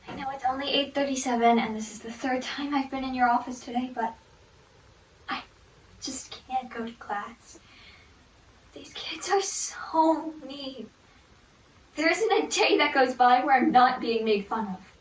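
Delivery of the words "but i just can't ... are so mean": slow hushed whisper; whispering to the guidance counselor. Acted. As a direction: breathe it out so nobody hears. Confiding to counselor.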